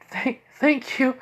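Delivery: high, slow, halting thanks; imitating an overwhelmed, high-voiced girl; tearful gratitude. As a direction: stammer it out, mock tearful. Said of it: "she just starts hugging and even crying".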